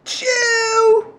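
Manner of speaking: high-pitched mimicked train call; imitating emphatic toddler. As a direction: copy a little child's emphatic train noise, very high. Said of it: "Look at the emphasis. You're like".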